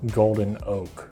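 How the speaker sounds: ordinary talk, nothing marked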